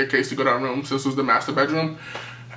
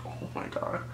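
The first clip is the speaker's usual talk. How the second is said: low, voiceless gasp of dread; hushed, acted disbelief; whispered shock; 13 semitones lower, 16 dB quieter than usual. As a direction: whisper it in stunned horror. Retold shock at emptied bag.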